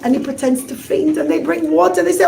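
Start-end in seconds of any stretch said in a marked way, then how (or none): none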